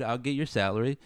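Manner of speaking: ordinary talk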